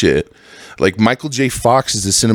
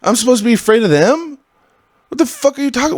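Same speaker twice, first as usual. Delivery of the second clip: sudden disbelief, voice jumping up high; shocked disbelief; 12 semitones higher, faster than usual. Retold or imitated. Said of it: "and I was like".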